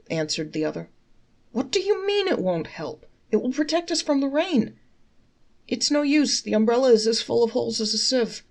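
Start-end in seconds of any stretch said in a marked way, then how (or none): 1.5-4.8 much higher, incredulous fool's voice; a baffled simpleton protesting; the umbrella-less sage objects in a high voice; puzzled fool. Acted. Fools argue about the umbrella. 5.7-8.4 higher, louder fool explaining patiently; the umbrella owner explains his holey umbrella; matter-of-fact fool. Acted. Fools argue about the umbrella.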